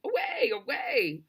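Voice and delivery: high, drawn-out whooping call; slow, high hollered call; distant shouting. A narrator's reading. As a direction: mimic faraway riders hollering.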